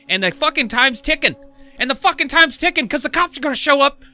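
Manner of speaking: high, loud, frantic retelling; freaking out. Retold or imitated. Man fearing the cops are gonna show up, freaking out. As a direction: relive the panic as the clock runs down. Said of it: "I'm freaking out".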